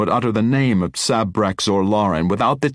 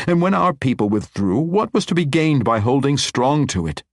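higher, louder, insistent reasoning; forceful persuasion; 6 semitones higher, 3 dB louder than usual. A narrator's reading. Matron convincing the wizard he did not fail, insistent. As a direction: drive the point into him.

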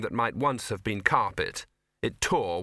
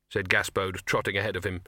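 plain reading voice

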